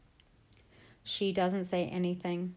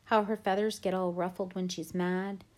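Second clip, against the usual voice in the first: usual speaking voice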